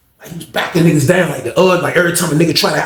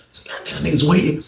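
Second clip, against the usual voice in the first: usual speaking voice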